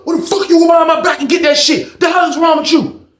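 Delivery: high-pitched angry tirade; screaming at someone. Retold or imitated. Inmate chewing out Skate over going behind his back, angry screaming.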